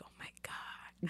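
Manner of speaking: hushed, breathless whisper. Retold or imitated. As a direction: mouth it in a hush to the hostess. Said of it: "We're like".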